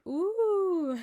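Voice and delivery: high, loud mock-impressed "ooh"; imitated family "ooh". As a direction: coo a big rising-then-falling "ooh" like a whole family. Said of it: "the whole family went".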